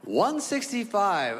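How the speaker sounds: high, drawn-out incredulous echo; aghast, stretched-out exclamation; shocked disbelief. Acted. Shocked repeat of the true weight.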